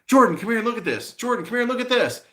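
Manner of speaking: high, fast mock call for Jordan; squeaky-quick imitation of an eager buddy; teasing imitation of a friend calling him over; mimicking friend calling. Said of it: "him saying".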